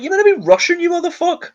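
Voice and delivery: hammed-up frustration at a bad accent; mock outburst at actor. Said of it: "I'm like".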